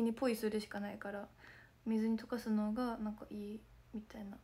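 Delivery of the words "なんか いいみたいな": hoarse whisper. Real, not acted. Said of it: "声ガサガサすぎる".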